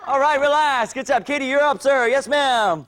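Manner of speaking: high, loud drill-leader voice; coach calling out. Real, not acted. Coach calling Katie up for her turn, loud.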